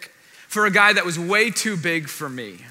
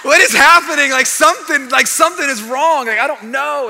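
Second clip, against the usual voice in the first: high, loud, laughing panic; half-laughing yelp of alarm; retelling his night panic through laughter; laughing mock panic; 7 semitones higher, 9 dB louder than usual. Waking in pain, panicked.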